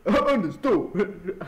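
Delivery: said through a laugh, slow; cracking up mid-word. Real, not acted. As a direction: giggle out the word. Laughing at own line.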